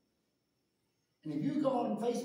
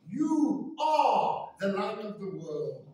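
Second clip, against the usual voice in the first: slow, forceful proclamation; emphatic exclamation; 2 semitones lower, slower than usual. Retold or imitated. Preacher quotes the Son of God speaking to him, emphatic exclamation. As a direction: declare it word by word with authority. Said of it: "It was an emphatic exclamation".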